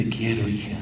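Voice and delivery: soft, hushed "te quiero" to a daughter; tender father. Retold or imitated. Father quietly telling his daughter he loves her, tender. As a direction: say it low and gentle, like a hug in words. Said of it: "cuando le dice".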